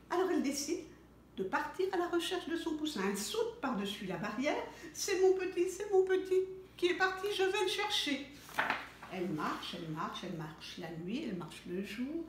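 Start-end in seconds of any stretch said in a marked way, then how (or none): 4.9-8.3 anxious mother. Acted. Mother hen distraught.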